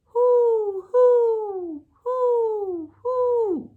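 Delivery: high loud hooting owl. Pretend. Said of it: "He can sound like an owl".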